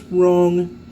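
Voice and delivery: a hammed-up snooty Englishman waving it off; a snooty English fella dismisses the fourth tree; snooty English dismissal. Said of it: "just simply says".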